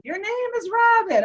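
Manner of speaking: high-pitched, insistent retold answer; emphatic certainty. Husband telling wife her name, emphatic certainty.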